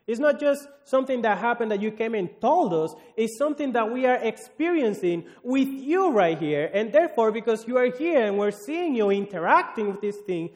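full-voiced sermon delivery; fervent preaching. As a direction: preach it out to the whole room. Preacher addressing Jesus, full force.

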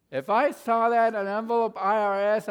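loud and high alarm; alarmed outburst. Real, not acted. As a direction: burst out as if the letter just arrived. Panic about IRS letter.

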